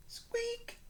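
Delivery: very high, soft squeak; squeaky shelf. Acted. The shelf creaking as George leans on it, high squeak. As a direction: make it squeak like a cart wheel. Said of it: "went the shelf again".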